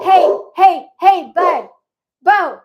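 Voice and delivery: high, loud shouts at the dog; real yelling. Real, not acted. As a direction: yell sharply to get a barking dog's attention. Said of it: "Sorry for yelling into the".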